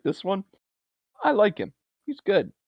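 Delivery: playful high character voice; high mock voice. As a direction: squeak it out in a silly voice. Imitating Nathan Fillion's character.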